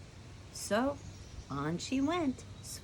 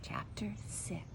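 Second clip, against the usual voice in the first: breathy whispered heading; 9 dB quieter than usual. Real, not acted. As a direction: whisper it like a secret.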